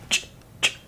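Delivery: "ch" whispered with no vowel; whispered pure ch. Shown as a demonstration. Teacher demonstrating pure "ch" without vowel. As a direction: breathe out a bare "ch". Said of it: "If you see this, it's like a".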